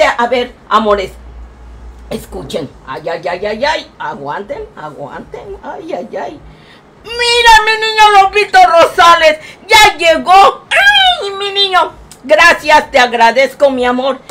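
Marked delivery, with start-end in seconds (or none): 2.1-6.4 hushed hold on. Acted. Grandma hushing her chat before the surprise, low and hushed. 7.0-11.9 high, loud, tearful cry of delight with a shrieking ay; crying shout of joy. Acted. Grandma welcoming her grandson to the stream, shrieking through mock happy tears. Birthday boy arrives on stream.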